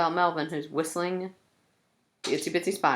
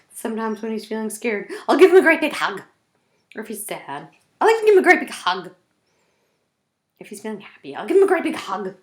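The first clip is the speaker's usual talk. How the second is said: a lightly voiced teddy, swelling louder and higher on each hug; gushing teddy bear; 5 semitones higher, 6 dB louder, faster than usual. Teddy bear tells kids about hugging Melvin, gushing. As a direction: squeeze the hugs out with joy.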